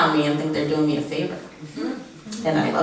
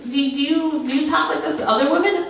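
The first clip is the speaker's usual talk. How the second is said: incredulous; 8 semitones higher than usual. Retold or imitated. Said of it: "I'm just like".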